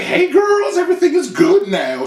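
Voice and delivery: imitating model greeting the girls. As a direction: gush at them sweetly. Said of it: "she come over like".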